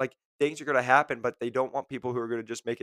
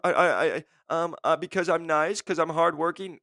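stammering nervous candidate, slightly higher; freezing candidate; 4 semitones higher than usual. Retold or imitated. Mock candidate stuck for an answer, stammering. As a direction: stumble like a frozen interviewee. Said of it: "That's where you guys all freeze".

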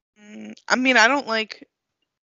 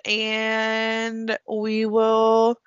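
slow drawn out; much slower than usual. Pretend. Drawn out sign-off.

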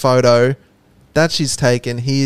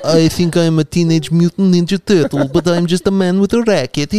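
higher-pitched, hammed-up tennis star impression; Rafael Nadal; 4 semitones higher, 3 dB louder than usual. Joker imitating a tennis star on request, hammed-up impression. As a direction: put on a mock star's voice, lifted and punchy. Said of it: "Rafael Nadal".

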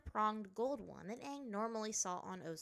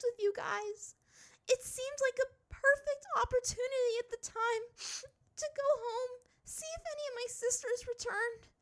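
put-on high, sobbing girl; crying; 8 dB louder than usual. A narrator's reading. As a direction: pour it out through tears. Ty Lee crying.

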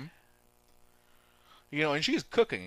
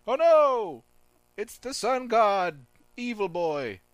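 high, loud cry of dread; mock alarm; 10 semitones higher, 8 dB louder, slower than usual. Caveman spotting the evil sun god, mock alarm. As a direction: cry out in hammed-up fright, high and drawn out. Voices alarmed characters at villain.

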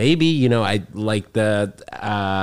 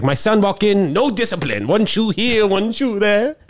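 high, clipped Hong Kong accent impression; Jackie Chan; 11 semitones higher, slower than usual. Jackie Chan complaining about his son, high Hong Kong accent. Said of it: "He's like, I heard Jackie Chan say that".

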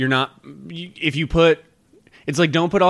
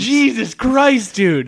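shocked; 8 semitones higher, much slower than usual. Real, not acted. Aftermath of shocking video.

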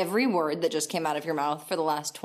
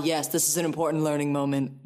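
lightly voiced pompous director, brisk; a hint of a self-important teacher; mock director; 3 semitones lower, faster than usual. Mocking the director's pompous words.

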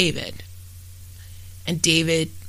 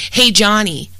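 brief raised-voice quote inside narration; retold shout; 4 semitones higher, 8 dB louder than usual. Narrator quotes killer calling to Johnny before shooting, retold shout. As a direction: call out louder, as a quoted shout. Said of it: "then shouted".

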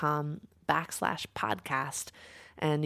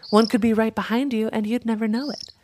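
higher-pitched suspicious grumbling; indignant crow; 7 semitones higher than usual. A narrator's reading. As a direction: work yourself up about those owls. Crow rants about owls.

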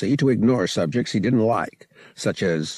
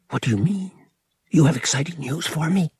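eager questioning; 7 semitones higher, faster than usual. A narrator's reading. Boy eagerly questions his night visitor.

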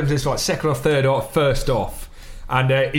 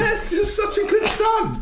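high, slow, laughing tease; slower than usual. Real, not acted. Host teasing the guest while laughing. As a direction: laugh the words out in mock praise.

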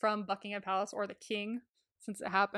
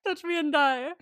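relished repeat; 5 semitones higher, 4 dB louder than usual. Retold or imitated. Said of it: "that was so good".

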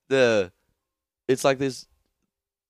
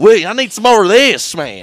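louder and higher, hungry for more; greedy excited shout; 10 semitones higher, 12 dB louder than usual. Retold or imitated. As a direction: keep yelling like the hyped dealer. Tuco voice continues.

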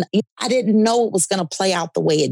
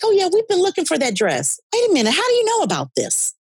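quoted client's rising astonishment, pitched much higher; astonished client; 11 semitones higher, faster than usual. Retold or imitated. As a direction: play the stunned client as her pitch climbs. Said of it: "She's like".

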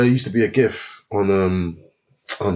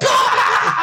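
mocking laugh-shout; 4 dB louder than usual. Group laughs at him.

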